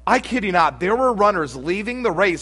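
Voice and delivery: ordinary talk, nothing marked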